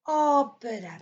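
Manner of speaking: opéra with long strong first O; stress on O. Shown as a demonstration. Teacher repeats Portuguese opéra, stress on O. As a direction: hit the O of opéra and fall away. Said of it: "il est sur le O".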